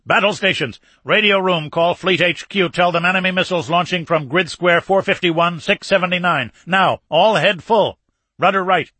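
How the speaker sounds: loud, high shouted commands; urgent battle orders. Acted. Captain to bridge as enemy missiles launch, urgent commands. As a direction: bark orders to the bridge under attack.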